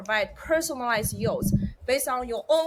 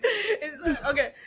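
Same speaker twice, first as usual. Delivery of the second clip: usual speaking voice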